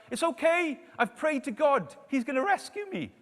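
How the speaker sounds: high, loud imitated shout. Stranded man turns away a plane, shouting up high and loud. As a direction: call up to a plane far overhead. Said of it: "he shouts up".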